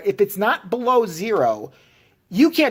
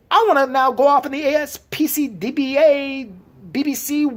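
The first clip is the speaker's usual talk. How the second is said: angry rant; 8 semitones higher than usual. Real, not acted. Ranting at ASPCA.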